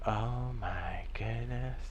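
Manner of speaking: slow, hushed mock awe; awestruck hush. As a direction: breathe it out in wonder. Mock awe whisper, slowed.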